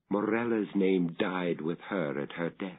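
ordinary delivery

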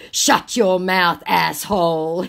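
slow, loud mock fury; hammed-up angry command; loud scammer retort. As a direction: belt it out slowly as the scammer. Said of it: "your eardrums going".

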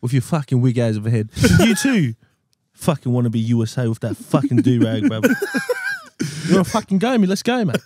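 play-acted aggro, attempting a Pommy accent; mock threat. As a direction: pick a pretend fight with your mates. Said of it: "I don't know how to speak Pommy accent".

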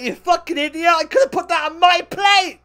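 yelling at her after she binned the food; retold shouting at date. Shouting after his date as she walks off, in hammed-up fury. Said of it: "I was like".

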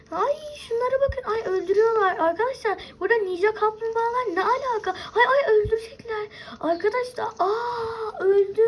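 high, crying panic as the enemies attack; a real frightened cry, pitch way up; panicked crying. Real, not acted. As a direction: wail in alarm while being killed. Ninjas attacking and killing her.

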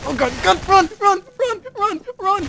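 very high panicked screaming; being chased. Real, not acted. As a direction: shriek while fleeing for your life. Fleeing the hunter in game.

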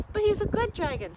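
put-on high, eager little boy; young boy voice. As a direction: plead like a small boy. Boy pleads for dragon.

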